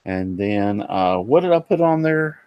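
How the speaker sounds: ordinary delivery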